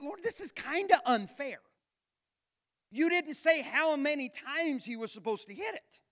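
mock complaint to God. Preacher retelling his prayer to God, mock protest. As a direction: complain to the Lord, half joking. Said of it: "I thought".

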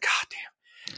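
hushed, whispered exclamation; whispered awe. Retold or imitated. Pilot reacting to the plane's quick snap, awed whisper.

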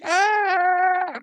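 high, piercing mock scream from the tape. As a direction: squeal it out like the victim on the recording. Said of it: "like that".

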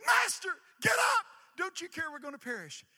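high, loud scream for help; terrified disciples yelling at sleeping Jesus; panicked scream. Acted. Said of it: "they scream at Jesus".

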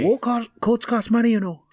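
high-pitched, put-out yell; indignant yelling. Acted. Marley protesting about the torn coat, high indignant yelling. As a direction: protest it high, as if being dragged off. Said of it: "Marley is yelling".

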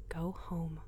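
hushed inner voice, close to the ear; a voice inside her head, whispering; whispered inner voice. Acted.

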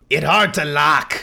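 slow, booming troglodyte guard; hissing reptile growl. Acted. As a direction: greet them like a lumbering lizard sentry. Troglodyte explains smashed door.

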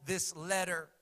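loud, high preaching cadence; fervent sermon delivery. Acted. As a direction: preach it out to the congregation. Preaching at full force.

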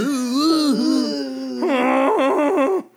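long, loud, high fake crying howl; hammed-up wailing; mock wail. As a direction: wail like a faked crash victim. Mock wailing of faked crash injury.

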